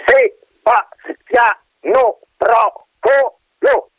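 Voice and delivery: high, very slow, each syllable spelled out; exasperated syllabling. Acted. Colonel spells his name to the wind, exasperated. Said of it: "sillabò esasperato".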